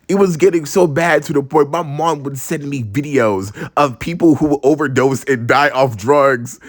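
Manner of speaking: ordinary talk, nothing marked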